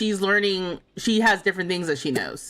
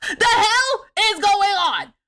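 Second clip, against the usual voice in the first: higher, louder, slow indignant yell; outraged shout; 11 semitones higher, 5 dB louder, much slower than usual. Real, not acted. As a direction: bellow it in disbelief. Outraged at paid rice recipe.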